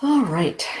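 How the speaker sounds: ordinary talk, nothing marked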